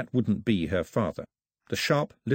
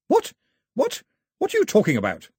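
put-on high, sharp indignant old lady; indignant grandmother; 11 semitones higher, 4 dB louder than usual. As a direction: demand an explanation.

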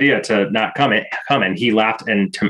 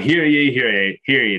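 town crier; 3 semitones higher than usual. Acted. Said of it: "I will begin everybody then with".

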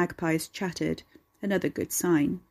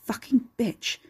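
muttered curse; 4 semitones higher, slower than usual. A narrator's reading. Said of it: "the man muttered".

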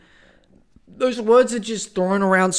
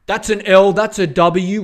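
a hint of a smug internet user; lightly voiced, louder slang quip; mock trendy slang; 6 dB louder than usual. Mocking internet users, smug slang quip. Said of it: "internet buzzwords that are becoming trendy".

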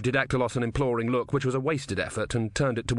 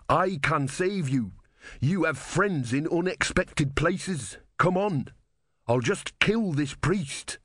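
higher voice with slow, weighty emphasis; urgent soldier; 6 semitones higher, slower than usual. Acted. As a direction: urge them on, stretching the key words. Voices a soldier urging rescue.

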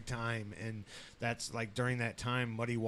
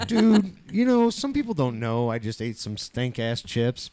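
loud protest; 9 dB louder than usual. Retold or imitated. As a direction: protest loudly at her. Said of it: "I was like".

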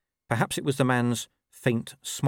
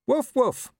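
high spoken woofs; talking dog; 4 dB louder than usual. Acted. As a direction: say the barks as words, high. Dog prompting the rider.

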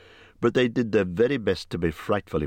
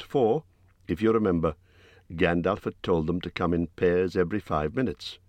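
plain reading voice

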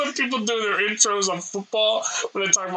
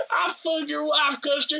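higher-pitched mock Crip threat; imitating Crip; 9 semitones higher than usual. Friend quoting a Crip's video for laughs, higher-pitched imitation. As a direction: put on the man's voice, pitched up. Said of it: "He's like".

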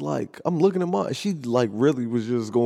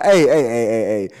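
calling out; 7 dB louder than usual. Retold or imitated. Retold calling out to stop.

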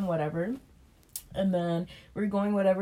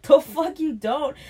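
retold angry refusal; 7 semitones higher, 8 dB louder, slower than usual. Refusing her demand in retold argument.

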